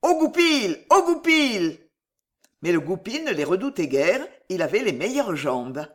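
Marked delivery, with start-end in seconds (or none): 0.0-1.8 high, loud cry of alarm. A narrator's reading. Merchants raise the alarm at the fleeing fox, crying out. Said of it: "Ils crient".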